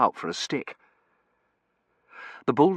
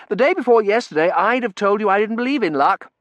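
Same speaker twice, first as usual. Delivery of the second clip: put-on higher, louder chatty young man; cheerful young Terence; 11 semitones higher, 7 dB louder than usual. A narrator's reading. Terence chattering to the narrator on the street, cheerful young voice. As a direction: rattle on cheerfully, full of beans.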